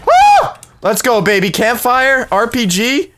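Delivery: high-pitched whoop, then elated chatter; excited celebration. Real, not acted. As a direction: whoop at lucky loot and keep it high. Celebrates a lucky weapon find.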